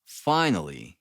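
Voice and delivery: usual speaking voice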